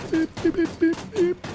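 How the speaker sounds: short, very high whistle bursts; a string of piercing little whistle peeps. Real, not acted. Gamer luring zombies, short high whistles. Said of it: "Let's do a little whistle".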